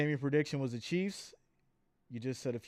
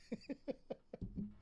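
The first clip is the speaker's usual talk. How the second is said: airy laugh; 9 semitones higher, 13 dB quieter than usual. Real, not acted. Laughs at own confusion.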